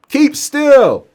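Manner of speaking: high, loud acted command; shouted order. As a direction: yell it at a struggling patient. Orders the struggling patient.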